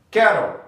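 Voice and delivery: cattle with a tapped T and a syllabic L, ca-dl; syllabic L demo. Shown as a demonstration. Teacher showing the silent vowel in cattle. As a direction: say cattle in two beats, no vowel before the L. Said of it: "o L passa a ser uma consoante silábica".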